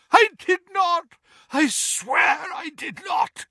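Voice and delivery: very high, desperate shout; terrified shouting. A narrator's reading. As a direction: plead in terror. Tortured man denies, terrified.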